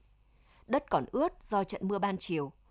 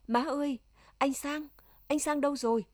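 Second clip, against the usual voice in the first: raised, higher-pitched call into the house; 6 semitones higher than usual. A narrator's reading. Loan calls indoors to her mother about missing Sang, calling out. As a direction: call for help finding someone, mildly urgent. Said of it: "Cô quay mặt vô trong gọi to".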